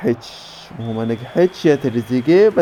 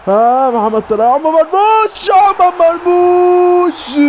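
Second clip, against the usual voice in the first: excited goal shout; 5 dB louder, slower than usual. Real, not acted. Gamer cheering Omar Marmoush's goal, real excited scream. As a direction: build from excited call to high, drawn-out scream. Goal chance commentary, calling the scorer.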